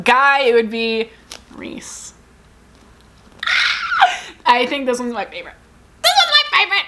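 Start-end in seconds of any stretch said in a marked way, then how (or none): 3.4-4.4 squealing giggle; high giggle. Real, not acted. Laugh after naming Reese. 6.0-6.8 high, loud gushing; excited squeal. Real, not acted. Repeating favorite, gushing.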